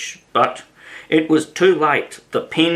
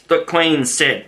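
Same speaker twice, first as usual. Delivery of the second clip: usual speaking voice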